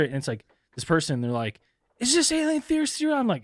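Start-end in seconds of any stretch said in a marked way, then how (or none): 2.0-3.1 mock caller. Voicing the caller.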